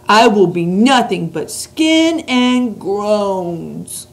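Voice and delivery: big booming slow giant; loud, slow friendly giant; BFG giant voice. Acted. Reads the BFG's lines.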